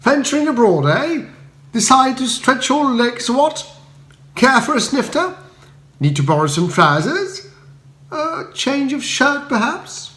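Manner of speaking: high, bright upper-class chirp; cheery Bertie. Acted. Bertie greeting Spode at the door, high and breezy. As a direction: greet him breezily, unbothered.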